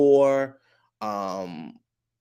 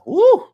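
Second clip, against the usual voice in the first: loud, high-pitched whoop; high "woo"; 10 dB louder than usual. Real, not acted. Exclaims at how wild episode was.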